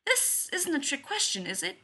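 high-pitched, anxious checking; uncertain. A narrator's reading. Jim suspecting a trick from Leonard, uncertain. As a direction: ask doubtfully, pitch lifted. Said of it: "answers Leonard's question uncertainly".

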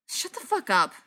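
loud, sharp snap; angry snap. A narrator's reading. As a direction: cut him off hard. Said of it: "Ryan snapped".

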